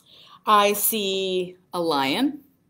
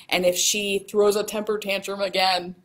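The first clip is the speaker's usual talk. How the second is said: ordinary delivery, nothing marked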